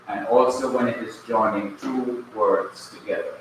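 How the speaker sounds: ordinary delivery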